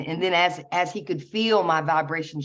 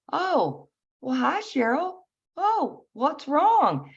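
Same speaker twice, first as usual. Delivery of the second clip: much higher, drawn-out mentor voice; imitated concerned greeting; 10 semitones higher, slower than usual. Mentor finding her in tears at night, high concerned voice. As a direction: imitate a gently surprised greeting, high and slow.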